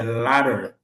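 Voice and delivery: ladder with American r, ladə˞, said slowly; model word. Shown as a demonstration. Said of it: "This is a ladder". (modèle lent : ladder, avec le r américain)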